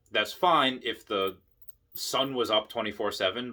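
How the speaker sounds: ordinary delivery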